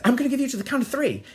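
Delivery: high, fast, loud warning; mock threatening mother. Mother warning son through the door, mock angry. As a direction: imitate a mother's rapid threat.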